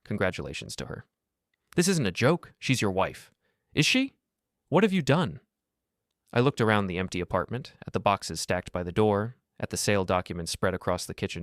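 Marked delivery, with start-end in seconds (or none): 1.8-5.4 outraged sister. Acted. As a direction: demand an answer in shock. Sister furious at husband.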